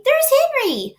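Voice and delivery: put-on very high, loud girl's call; excited shout. A narrator's reading. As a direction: call out eagerly, pointing. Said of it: "Don't shout, Fern".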